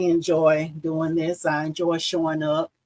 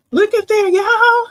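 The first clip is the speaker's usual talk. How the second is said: excited; 8 dB louder than usual. Real, not acted. Delighted at her finished embroidery.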